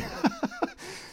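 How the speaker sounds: very high real laugh; squeal of laughter. Real, not acted. Laughing after acted shout.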